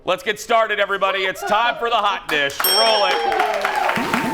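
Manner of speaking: high, loud host's call; rousing show opener. Real, not acted. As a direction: kick off the show for a cheering crowd. Host launches the show to audience.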